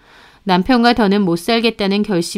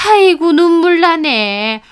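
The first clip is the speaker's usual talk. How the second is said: slow, higher, sarcastic fake weeping; mock tearful sigh; 8 semitones higher, 5 dB louder, slower than usual. Mother-in-law sneering at daughter-in-law, mock-tearful and slow. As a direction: pretend to be moved to tears, mocking her.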